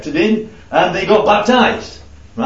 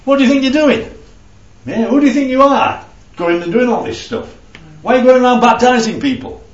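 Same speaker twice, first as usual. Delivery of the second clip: higher, louder mock-challenging questioners; challenging Pharisees; 7 semitones higher, 3 dB louder than usual. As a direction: demand his credentials, indignant. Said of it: "So they're basically saying is".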